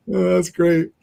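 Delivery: usual speaking voice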